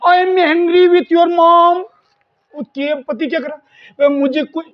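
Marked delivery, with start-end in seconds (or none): 0.1-1.8 very slow, leaning on angry; slow emphatic model. Shown as a demonstration. Teacher plays the wife telling the husband, slow and stressed. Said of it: "पत्नी कह रही पति को".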